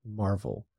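pause, then give "marvel" quiet weight; stress on marvel. Shown as a demonstration. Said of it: "he emphasizes that word, marvel".

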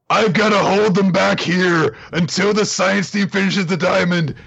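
loud, high, over-the-top heroic vow; hammed-up Taiju. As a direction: shout it like a big-hearted meathead. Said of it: "helpful idiot that he is".

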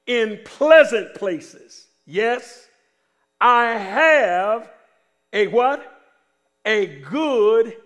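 loud, slow, phrase-by-phrase proclamation; preached scripture reading. Acted. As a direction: proclaim the verse, pausing after each phrase. Reads scripture out to congregation.

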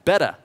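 "Better." said with no R, as "Betta". Shown as a demonstration. Teaching the congregation to speak Australian, "Better." without the R. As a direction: drop the R in "Better." like an Aussie. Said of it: "don't pronounce the third R".

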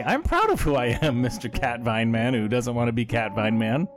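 ordinary delivery

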